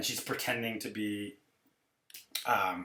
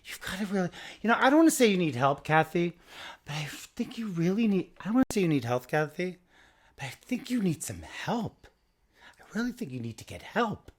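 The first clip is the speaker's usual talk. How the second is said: mock Lisa lecture; 7 semitones higher, 5 dB louder than usual. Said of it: "she's like".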